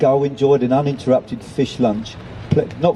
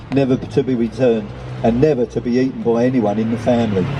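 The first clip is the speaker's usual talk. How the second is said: plain reading voice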